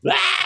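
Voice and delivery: sudden high, loud "Ah!"; imitated scream. Director imitating the actor who screams on request, mock scream. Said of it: "he starts, like, screaming and going crazy".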